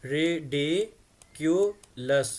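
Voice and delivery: ridiculous said very slowly, each of its three syllables apart; three clear syllables. Shown as a demonstration. Teacher showing students: Ridiculous broken into three slow syllables. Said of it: "three syllables हैं इसमें".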